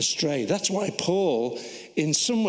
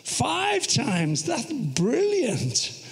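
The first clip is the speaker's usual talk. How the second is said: mock admiration; 7 semitones higher, much slower than usual. Congratulates pretend Paul on whippings.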